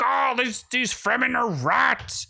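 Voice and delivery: high, put-on sneering character voice; hammed-up high villain quote; mock villain voice. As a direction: pitch it way up and sneer like a camp villain. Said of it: "He's like".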